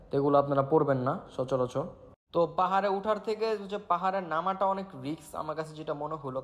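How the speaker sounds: usual speaking voice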